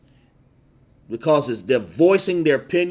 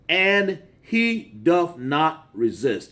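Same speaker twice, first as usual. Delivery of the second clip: slow drawn out reading; much slower than usual. Acted. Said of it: "preacher drags the verse out".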